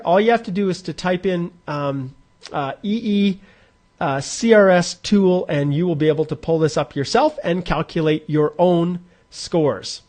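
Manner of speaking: ordinary delivery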